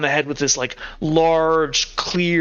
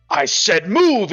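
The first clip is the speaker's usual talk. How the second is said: slow, louder, higher order; angry command; 4 semitones higher, 5 dB louder, slower than usual. Acted. Boss shoves henchman onward, forceful acted order. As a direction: bear down on a reluctant underling.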